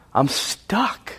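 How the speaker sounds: breathless whispered confession; a whispered admission of being stuck. Acted. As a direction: whisper it like you're trapped. Whispered despair in prayer.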